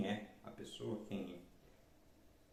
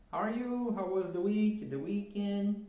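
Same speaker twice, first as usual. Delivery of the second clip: higher, bright greeting to students; teacher greeting her pupils; cheery class greeting; 7 semitones higher, 4 dB louder, slower than usual. Shown as a demonstration. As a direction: greet the class at the start of a lesson. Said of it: "sempre faço no início das aulas para meus alunos".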